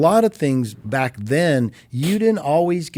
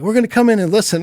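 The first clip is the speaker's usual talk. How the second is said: much higher, fast excited voice; band announcing; 10 semitones higher, much faster than usual. Retold or imitated. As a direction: rattle it off like an eager band. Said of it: "they're like".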